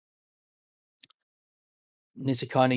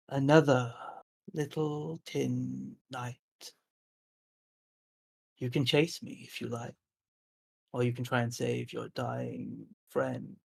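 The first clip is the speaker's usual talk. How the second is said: taunting forest creature; 5 dB quieter than usual. Acted. Wooden figure taunting those charging from the woods, softly menacing. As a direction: taunt softly, with long pauses between phrases. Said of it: "you hear a voice from all around you".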